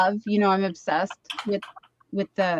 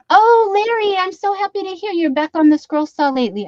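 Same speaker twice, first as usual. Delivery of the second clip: excited greeting; 10 semitones higher, 5 dB louder than usual. Real, not acted. Greeting viewers in live chat.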